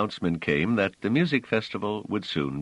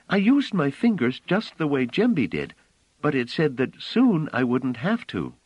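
put-on child voice; 10 semitones higher, 4 dB louder than usual. Phelim asking Bliss, high childlike voice. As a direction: play the small innocent girl, up high.

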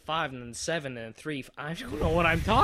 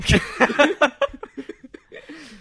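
loud high laugh; 12 semitones higher, 7 dB louder than usual. Real, not acted. Laughing at cake joke.

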